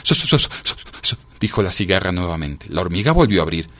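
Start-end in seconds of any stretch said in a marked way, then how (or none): 0.0-1.2 whispery, chattering syllables; shivering stammer. A narrator's reading. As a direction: stutter helplessly from the cold. Cicada stammers from cold outside.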